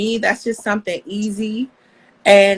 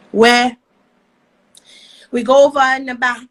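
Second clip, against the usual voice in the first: hammed-up Aussie accent, faster and louder; Australian accent; 4 semitones higher, 7 dB louder, faster than usual. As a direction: rattle it off in a joking Australian accent. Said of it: "Can you do Aussie?".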